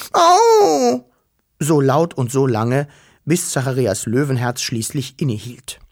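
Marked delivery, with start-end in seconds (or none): 0.1-1.0 very high, loud cries of pain; pained yelps. A narrator's reading. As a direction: squeal like a small creature being spanked. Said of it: "schrie er". (hohe, laute Schmerzensschreie)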